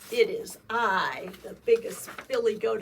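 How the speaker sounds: loud proud big goat; big goat announcing. A narrator's reading. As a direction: announce yourself boldly.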